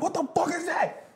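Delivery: high, outraged squeal of disgust; mock-furious high cry after spitting out water; outraged yell. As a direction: friend spits out the water and yells in high outrage. Friend spits out water, outraged.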